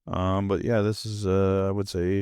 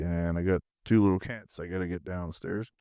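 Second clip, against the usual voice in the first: ordinary delivery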